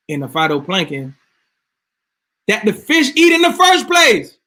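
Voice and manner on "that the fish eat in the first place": real yell, much higher and louder. Real, not acted. Lecturing viewers on fish, the speaker yells at them. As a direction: shout it out, really fired up. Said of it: "Sorry just for yelling at y'all".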